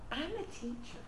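very soft, measured reply; gently polite. Shown as a demonstration. Teacher correcting someone who called her a babysitter, gentle and quiet. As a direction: say it softly and deliberately, with quiet assurance. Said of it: "you gently and politely".

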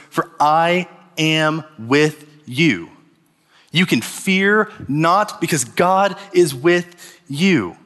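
slow, deliberate proclamation; slow preaching emphasis. Acted. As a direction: draw each word out for the congregation.

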